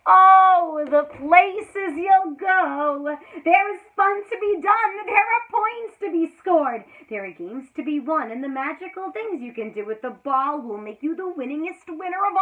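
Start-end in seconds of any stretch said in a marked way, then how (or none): 0.1-6.8 loud, high, jubilant cheer; loud, high triumphant proclamation; exultant. Acted. Exultant passage in reading.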